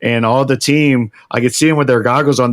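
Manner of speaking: ordinary talk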